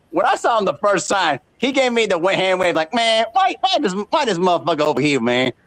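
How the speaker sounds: ordinary talk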